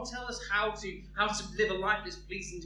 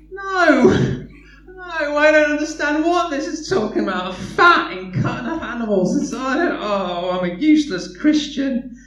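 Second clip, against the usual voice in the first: high, loud, frantic wail; panicked believer; 7 semitones higher, 11 dB louder than usual. Acted. A new believer panics, high and loud, over Leviticus. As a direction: cry out in despair over it.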